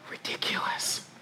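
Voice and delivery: hushed, voiceless aside; very soft whisper; whispered dismissal. Real, not acted. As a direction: whisper the verdict under your breath. Whispered verdict on own worry.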